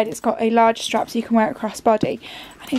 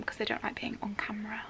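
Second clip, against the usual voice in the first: hushed whisper so nobody hears; 16 dB quieter than usual. Real, not acted. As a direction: whisper it, keeping it secret. Others nearby dislike camera.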